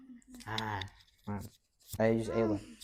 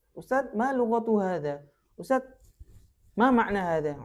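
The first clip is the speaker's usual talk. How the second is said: acting the student; 6 semitones higher than usual. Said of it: "tanya ke ustaz".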